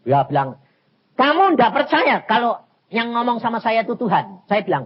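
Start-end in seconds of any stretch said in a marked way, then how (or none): 1.2-4.5 loud, higher-pitched indignant challenge; angry prophet. Retold or imitated. Angry prophet challenges the preacher who refuses him, indignant. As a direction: imitate an offended prophet, raised voice. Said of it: "Nabi ini marah".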